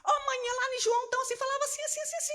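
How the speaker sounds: a young boy voiced high, eager to explain; high boyish voice telling mom about the sermon; son's voice. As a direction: play her little son excitedly teaching his mother. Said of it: "o menino chegava pra mim e falava assim".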